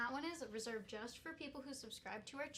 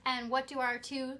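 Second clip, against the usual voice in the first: booming prize announcer; 10 dB louder than usual. Acted. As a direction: build up the prize like a host. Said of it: "announcing bonus giveaway prize".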